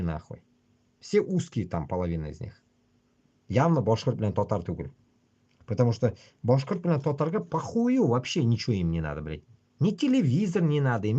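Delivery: ordinary delivery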